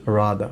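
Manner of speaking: "rather" as RAA-dhuh, ending in a schwa; silent final r. Shown as a demonstration. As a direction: say "rather" low with a long a and no closing r. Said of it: "দেখেন কি বলছি". ("rather"-এর শেষের র বাদ দিয়ে বলা)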